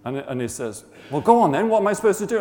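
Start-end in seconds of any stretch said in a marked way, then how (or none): 1.1-2.4 raised, louder goading question; impatient demand. Retold or imitated. Workmate pressing Rog for God's answer, impatient. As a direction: push for an answer, high and loud.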